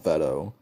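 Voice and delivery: "Veto" with a short e, as in "vet"; "Veto" pronounced with first syllable like "vet". Shown as a demonstration. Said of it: "I think it's pronounced Veto".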